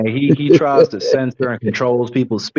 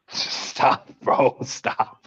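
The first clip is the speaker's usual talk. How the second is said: laughing; 6 dB quieter, much slower than usual. Real, not acted. Laughing at being teased.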